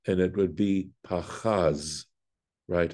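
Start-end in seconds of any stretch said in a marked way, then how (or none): 1.1-2.0 "pachaz" as pa-CHAZ, weight at the end; stress on second syllable. Shown as a demonstration. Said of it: "would be on the second syllable".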